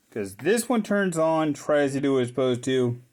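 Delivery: ordinary delivery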